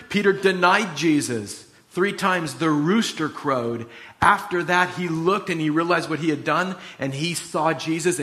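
ordinary delivery

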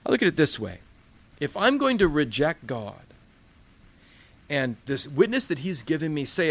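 ordinary talk, nothing marked

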